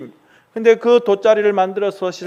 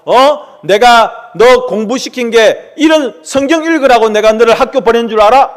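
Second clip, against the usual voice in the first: loud, higher, indignant rebuke; furious scolding; 5 semitones higher, 6 dB louder than usual. Acted. Father berating his son for reading the Bible, loud furious rebuke. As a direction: tear into him like an outraged dad.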